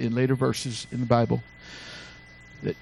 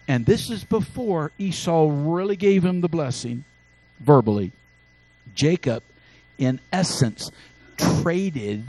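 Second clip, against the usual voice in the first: ordinary delivery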